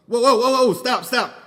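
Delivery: loud, high cries to halt; startled loud outburst at his dog; real startled shout. Real, not acted. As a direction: yell stop as the dog misbehaves. Startled, stopping the recording.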